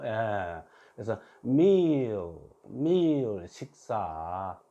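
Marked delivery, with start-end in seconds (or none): 1.4-3.5 slow, stretched "meal" sliding from mi to o; "meal" modelled for learners as a long mi-ol; weak final L. Shown as a demonstration. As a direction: draw out "meal" and let the final L fade. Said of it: "그래서 끝에 왔으니까 약하게".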